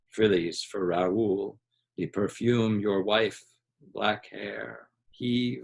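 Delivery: drowsy half-dream mumble trailing into silence; drifting into sleep. Acted. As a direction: let the words dissolve as he dozes off.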